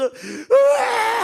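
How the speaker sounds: long, high, loud wail; tearful scream. Retold or imitated. Preacher imitating the blind man's desperate tearful cry, loud wail. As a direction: imitate a sobbing man screaming out. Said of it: "울먹거리면서".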